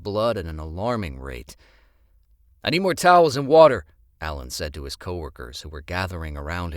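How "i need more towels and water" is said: fast, loud, high order; urgent demand. Acted. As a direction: rush them with a loud demand.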